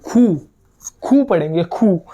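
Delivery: खू with a breathy kh and long u; teacher demonstrating Q as खू; German Q as khu. Shown as a demonstration. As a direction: push air on the kh of खू. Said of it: "क्यू को हम पढ़ेंगे".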